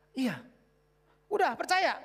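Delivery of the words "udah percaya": eager question. Retold or imitated. Acted question to the listener.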